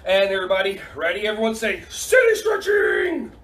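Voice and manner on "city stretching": high, drawn-out shout; rallying cheer. Shown as a demonstration. Coach leading kids to repeat, high excited cheer. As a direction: stretch it out slowly, way up high.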